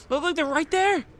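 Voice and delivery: high, loud exasperated shouting; frustrated yelling. Real, not acted. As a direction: rant at the screen in disbelief. Raging at teammates' mistakes.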